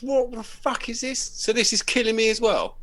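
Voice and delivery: high, loud panicked customer; angry customer yell. Retold or imitated. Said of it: "he just rushed in and goes".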